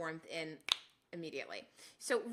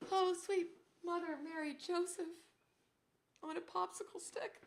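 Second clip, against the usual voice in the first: high, strained exclamation at the cold; cold-shocked oath; 6 semitones higher, 3 dB quieter than usual. Real, not acted. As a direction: swear it high, reeling from icy shock. Reacting to freezing socks.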